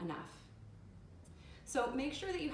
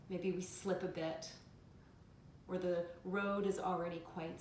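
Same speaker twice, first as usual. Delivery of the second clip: calm, measured meditation guide; guided meditation. Acted. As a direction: guide listeners inward with unhurried pauses.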